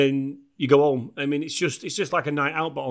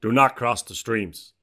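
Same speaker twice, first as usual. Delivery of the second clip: low, slow deadpan American character; Egon; 5 semitones lower, slower than usual. Shown as a demonstration. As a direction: deliver a film line low and slowly. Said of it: "I'll do you, Egon".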